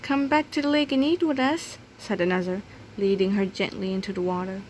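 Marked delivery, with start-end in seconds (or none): 0.0-1.8 higher, gentle coaxing; coaxing flamingo. Acted. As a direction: coax her back in. Another flamingo coaxes Bibi.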